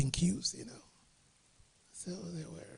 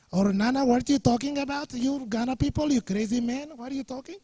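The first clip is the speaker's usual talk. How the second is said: imitating a Bangladeshi accent, higher and louder; hammed-up Bangladeshi accent, pitched up; 9 semitones higher, 5 dB louder than usual. Imitating a Bangladeshi friend teasing Nana on the bus, mock Bangladeshi accent. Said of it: "That kind of accent".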